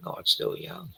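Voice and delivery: breathy whispered half-joke to himself; whispered aside. Real, not acted. Streamer muttering to himself, softly whispered. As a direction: hush it out like a private aside. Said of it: "says the line twice, first take".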